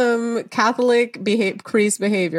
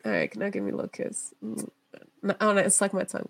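creepy old-man imitation; 10 dB quieter, faster than usual. Mimicking the Dalai Lama talking to the child, creepy and hushed.

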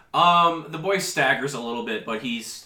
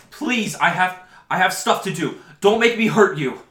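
high, louder, near tears; tearful threat; 8 semitones higher, 8 dB louder than usual. Acted. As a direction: threaten while terrified. Scared boy threatening with knife.

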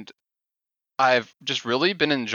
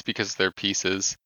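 normal talking voice

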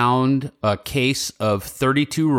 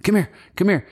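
coaxing a squirrel; 8 semitones higher than usual. Retold or imitated. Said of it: "he's like".

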